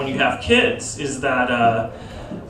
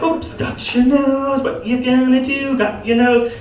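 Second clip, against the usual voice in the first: put-on high, slow singsong to a baby; a high, drawn-out baby-talk singsong; playful baby game; 9 semitones higher, slower than usual. Shown as a demonstration. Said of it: "We all know this one".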